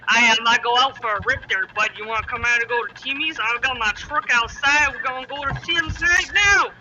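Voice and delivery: a hint of a Canadian guy heading to Tim's; Canadian imitation. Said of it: "a lot of people talk like this".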